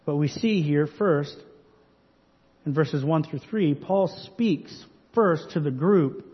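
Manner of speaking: usual speaking voice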